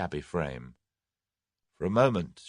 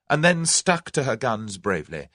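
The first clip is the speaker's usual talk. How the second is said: plain reading voice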